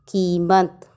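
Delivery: कीमत with a long ee pressed in the first syllable; long stressed i. Shown as a demonstration. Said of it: "शब्दों पर जोर देते हैं".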